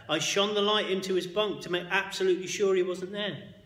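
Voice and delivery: ordinary delivery, nothing marked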